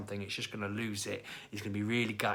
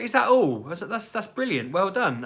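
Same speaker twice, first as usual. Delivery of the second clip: high, loud gushing praise; relieved delight; 10 semitones higher, 9 dB louder than usual. Retold or imitated. Relieved reaction to son's secret.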